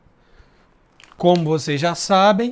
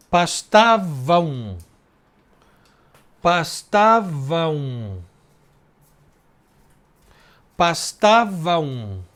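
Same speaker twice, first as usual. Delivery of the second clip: drawn out, syllable by syllable; slow syllable count; much slower than usual. Shown as a demonstration. Teacher scanning syllables for students, slowly drawn out. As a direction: stretch each syllable to count it. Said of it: "já tem uma elisão".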